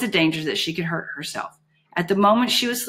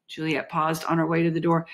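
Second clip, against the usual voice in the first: ordinary delivery, nothing marked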